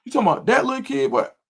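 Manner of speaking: heated rant, pitch climbing high; going off. Retold or imitated. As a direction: tell the dad off, voice rising and fast. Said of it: "when I tell you I went off".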